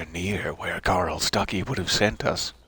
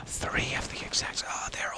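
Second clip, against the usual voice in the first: breathless, hushed amazement at the crows; gasped surprised whisper; 2 semitones higher, 8 dB quieter than usual. Real, not acted. Gamer spotting identical crows, gasped awed whisper.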